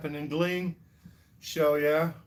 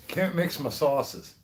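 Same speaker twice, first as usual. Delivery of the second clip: ordinary talk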